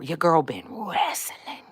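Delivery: whispered, drawn out slowly. Real, not acted. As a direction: lean in and breathe it out. Confessing spiritual struggle, whispered.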